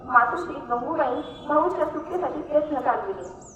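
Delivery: plain reading voice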